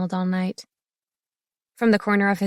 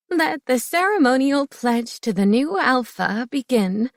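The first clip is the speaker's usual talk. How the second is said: higher voiced elder proclaiming; old woman elder; 5 semitones higher than usual. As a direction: announce it like the pack elder. Elder opens the pledge ceremony.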